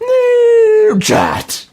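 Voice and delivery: very high held shout; shrill jingle cry; squeaky announcer yell. Pretend.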